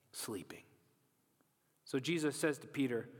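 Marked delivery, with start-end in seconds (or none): 0.1-0.6 soft, lower whisper. A narrator's reading. Preacher revealing the sleeping friends, whispered. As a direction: let the word fall away in a whisper.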